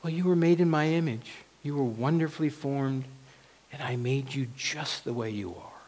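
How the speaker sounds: soft, affirming reply; God's voice. Acted. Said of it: "And God's voice says".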